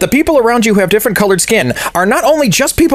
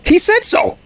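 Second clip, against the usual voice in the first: mock gullible fan; 14 semitones higher, slower than usual. Mocking gullible Trump fan answer.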